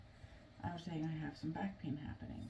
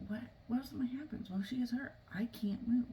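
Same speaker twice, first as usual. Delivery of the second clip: mild panic, pitch lifting on the worries; retold panic; 6 semitones higher than usual. Mother fearing for her two-year-old, mildly panicked retelling. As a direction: replay anxious what-ifs, voice rising a little. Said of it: "panicking as well because".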